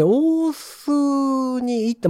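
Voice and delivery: ordinary talk, nothing marked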